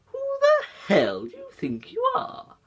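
very high cartoon character voice, drawn out; slow, high-pitched indignant cartoon demand; Stewie Griffin. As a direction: pitch it way up and stretch it slowly. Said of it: "Stewie Griffin, I've always been good".

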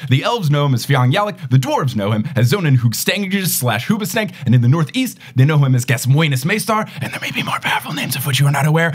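breathless roll of wizard titles; pompous, driving fantasy introduction; grand title announcement. Acted. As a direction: herald a great wizard, title after title. Host recites wizard guest's grand titles.

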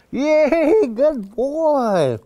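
high, bright burst of praise for the dog; happy dog praise. Shown as a demonstration. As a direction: cheer the dog on like he just won. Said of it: "now I'm super happy".

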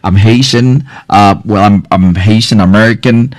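usual speaking voice

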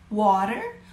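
usual speaking voice

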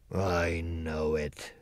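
low, soft, very slow admission; ashamed. Acted. The lion admits to Dorothy he is a coward, low and ashamed. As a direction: hang your head and admit it. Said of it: "hanging his head in shame".

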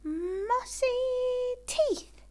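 loud high sneeze on the words; sneezed words. Acted. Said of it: "When she sneezed, it sounded as if".

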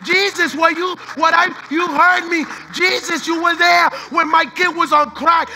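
Hannah crying out to Jesus. Acted. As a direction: shout her thanks to Jesus with a broken voice. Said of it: "she's saying".

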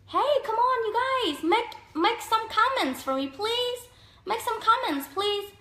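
high, drawn-out plea to viewers; playful pleading. Real, not acted. Asking live viewers to make some comments, playful high-pitched pleading.

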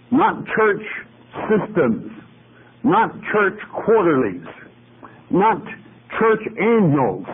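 each not hit high and hard; preacher rejecting church systems, stressing not; emphatic not. Real, not acted. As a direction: punch every not up high. Preacher lists what not to use.

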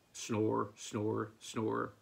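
low, sleepy, evenly spaced words; contented snoring. A narrator's reading. Farmer hears sleeping hens, low contented snores. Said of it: "and contented snoring".

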